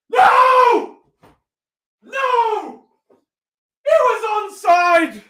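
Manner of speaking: very high slow cries of outrage; anguished shout. Real, not acted. Fan howling that Saka was onside, real outrage. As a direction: howl in disbelief. Goal chance wrongly lost.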